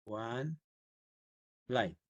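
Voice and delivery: word by word, long gap between; dictating while typing. Real, not acted. Teacher typing git log option, softly word by word. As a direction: say it softly to yourself as you type each word.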